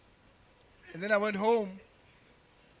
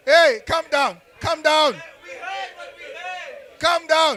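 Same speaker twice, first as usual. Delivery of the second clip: louder call to settle; calming the crowd down; 4 semitones higher, 3 dB louder, slower than usual. Real, not acted. Comic hushing the laughing audience, loud and firm. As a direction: hush the laughing crowd firmly.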